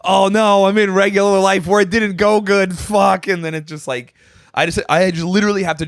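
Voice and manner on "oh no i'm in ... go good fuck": high-pitched mock despair; waking dismay. Waking from a good dream to regular life, dismayed. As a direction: wail it in exaggerated dismay.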